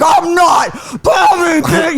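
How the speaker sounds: high, drawn-out mock tantrum yell; enraged shout. Goaded brother exploding at his sister, enraged shout. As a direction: imitate a brother losing it completely.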